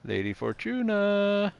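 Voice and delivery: high, slow call across the room. Real, not acted. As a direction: call out her name, drawn out. Calling out to find someone.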